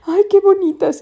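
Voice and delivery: high, loud admiring coo; delighted at flowers she thinks are real; delighted gush. Retold or imitated. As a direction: gush over pretty flowers. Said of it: "y dije".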